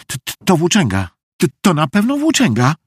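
stammering, high, fast and nervous. A narrator's reading. Meg suspects a tramp outside, nervous stammer. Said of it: "powiedziała Meg nerwowo".

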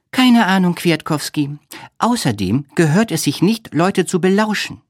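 high-pitched, mildly reproving friend; Olga. A narrator's reading. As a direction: scold lightly in a high voice. Olga shrugs, then reproves.

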